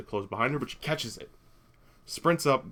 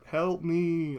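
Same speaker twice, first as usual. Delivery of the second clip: higher, sustained mock call; imitated cry for help; 6 semitones higher than usual. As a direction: hold the words like a distant plea. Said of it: "that he calls out".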